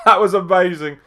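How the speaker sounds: high, loud burst right after a laugh; thrilled outburst. Real, not acted. Fan cracking up at the match, thrilled outburst. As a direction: blurt out pure delight.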